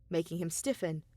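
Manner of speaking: ordinary delivery, nothing marked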